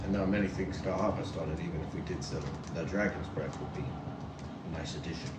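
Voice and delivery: low character voice. Acted.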